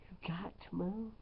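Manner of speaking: quick whisper; whispered aside. Real, not acted. DJ whispering to the audience that complainers must move. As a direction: drop to a hushed aside.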